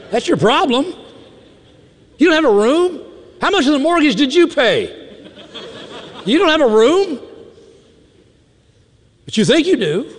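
put-on high-pitched disbelief, playfully chiding a child; teasing, high-voiced mock outrage; mock incredulous scolding. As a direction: take a spoiled kid down a peg, comically high.

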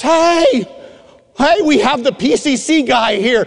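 high, loud hail to the room; calling out. Retold or imitated. Service leader to the whole room, calling out.

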